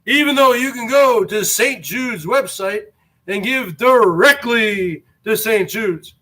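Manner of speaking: ordinary delivery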